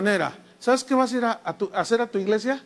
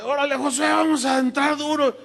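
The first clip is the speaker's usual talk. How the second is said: mock war cry; 5 semitones higher than usual. People urging Joshua to fight.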